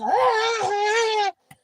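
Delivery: long high-pitched mock wail; imitated crying scream; Tau. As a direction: wail loudly in comic despair. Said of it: "And, and Tau does this".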